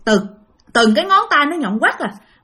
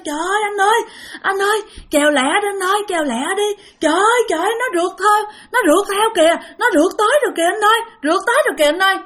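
retold panicked cry, pitched much higher; la hoảng; 9 semitones higher, faster than usual. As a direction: plead urgently in a high, frightened voice. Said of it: "bả mới la lên". (la lên hoảng hốt, giọng cao vút)

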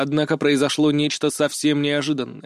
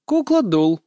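higher, with a smirk; amused; 5 semitones higher, 4 dB louder, slower than usual. A narrator's reading. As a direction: answer with wry amusement. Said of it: "с усмешкой ответила Эйра". (с усмешкой)

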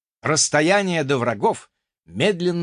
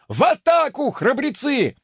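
ranting shout; 11 semitones higher than usual. Acted. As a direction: keep yelling at the troops. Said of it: "продолжал разоряться непись".

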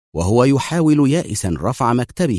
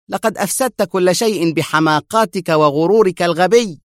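raised, bitter scolding; resentful; 8 semitones higher, 3 dB louder than usual. A narrator's reading. Vondrik blaming wounded Henrik, resentful. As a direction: spit out the blame, irritated. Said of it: "أجابه فوندريك في حنق".

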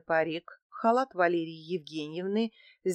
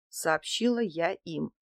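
usual speaking voice

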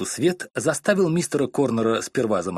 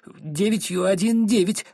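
a touch of tearful, slow, higher voice; sobbing recital; 9 semitones higher than usual. Acted. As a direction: recite through light sobs. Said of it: "всхлипнула несчастная женщина". (немного плаксиво, медленно и выше)

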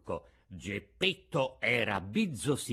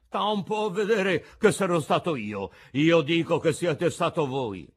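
loud, higher-pitched accusation; quarrelling; 6 semitones higher, 7 dB louder than usual. A narrator's reading. Old man accuses the carpenter in a quarrel, loud and cross. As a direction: throw the blame back loudly.

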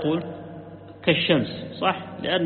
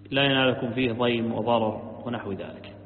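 ordinary talk, nothing marked

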